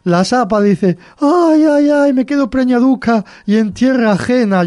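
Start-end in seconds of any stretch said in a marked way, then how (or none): 1.2-4.7 high, loud wailing; theatrical high-pitched moaning; mock tearful lament. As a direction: wail it like a hammed-up weeping widow. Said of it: "llorando, lamentándose".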